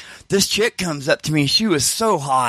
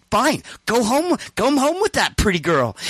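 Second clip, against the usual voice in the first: high, huffy imitated comeback; acted catty send-off; mocking retort; 8 semitones higher than usual. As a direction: dismiss him with sarcastic permission. Friend retorts in acted quarrel.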